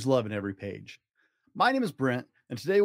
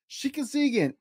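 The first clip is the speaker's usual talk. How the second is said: put-on much higher sarcastic kid voice; mock amazement; 11 semitones higher than usual. Brother mocking sister's recovery, high mock amazement. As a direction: act astonished with a wink.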